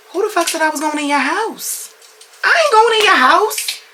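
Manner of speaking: high-pitched, indignant outburst; outraged refusal. Retold or imitated. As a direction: shoot up high in loud, incredulous protest. Outraged refusal of going inside.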